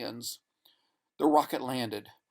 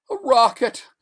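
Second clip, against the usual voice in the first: high, loud tearful cry; 12 semitones higher, 11 dB louder than usual. Acted. Stranded man rubbing his eyes, tearful cry. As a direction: cry it out through tears. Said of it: "he cried, rubbing his eyes".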